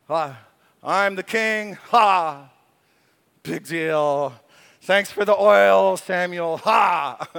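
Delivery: a hint of a smug king scoffing; mocking king. Preacher playing a dismissive king, mock scoff. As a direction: toss it off like a bored king.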